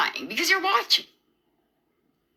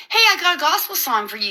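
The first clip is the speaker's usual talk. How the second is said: mocking taunt; 6 semitones higher, faster than usual. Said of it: "mocking me".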